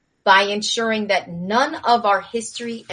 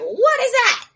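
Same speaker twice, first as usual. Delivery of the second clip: high, loud startled cry; 5 dB louder than usual. Retold or imitated. Crash survivors spotting the attacker, startled. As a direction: gasp it out in alarm. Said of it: "and they're like".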